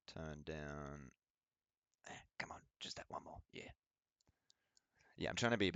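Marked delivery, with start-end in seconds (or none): 2.1-3.7 hushed whisper after turning the mic down. Real, not acted. Gamer whispering at night after turning his mic down. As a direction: breathe it without voice, keeping it down.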